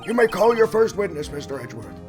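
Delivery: put-on high, creaky old judge voice; old man voice. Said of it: "my old man voice".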